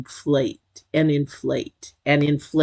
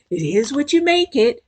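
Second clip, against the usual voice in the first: lightly mimicked saying, pitched up; imitated objector; 10 semitones higher than usual. Reader quoting a saying they hate, higher mimicking voice. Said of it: "somebody saying".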